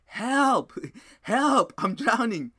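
high, loud, imitated cry for help, with a laugh; mock scream for help. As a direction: yell it like a half-joking drowning man. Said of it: "screaming on top of my lungs".

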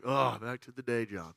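low, soft, deflated groan; let down. Acted. Worker home from a mission trip, deflated. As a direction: sink into mild mock gloom. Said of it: "we experience a bit of a letdown".

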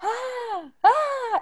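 high yelling "Ah, ah" at a gator; mock gator call. Coworker calling the gator with a high cry. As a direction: call out to the swamp, high and drawn. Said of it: "all I hear is".

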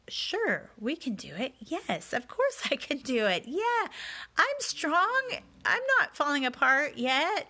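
a strained, too-bright chirp of agreement; forced cheerful yes. Retold or imitated. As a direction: fake it brightly, insisting she's fine. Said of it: "So I gave in and I said".

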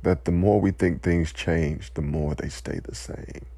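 quiet, choked-up words fading to breath; emotional. Real, not acted. Speaker overcome by grief for victims, quiet and choked. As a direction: hold back tears as the voice gives out. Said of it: "I'm becoming emotional".

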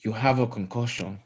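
a deliberately bad Nigerian accent on You have a concussion; lightly put-on Nigerian accent, half-hearted; Nigerian accent impression. Shown as a demonstration. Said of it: "Do the impression".

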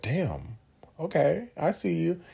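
admiring flirty. Retold or imitated. Admiring himself in the mirror.

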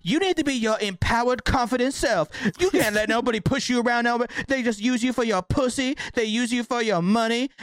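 mock motivational guru preaching empowerment; empowerment video. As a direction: hype her up like a motivational YouTuber. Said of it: "a YouTube video that was like".

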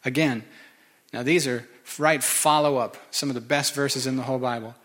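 ordinary talk, nothing marked

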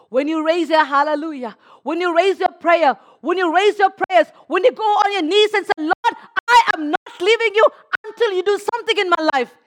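loud, high, rousing preacher; full-force preaching. Acted. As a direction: build it up like a revival preacher.

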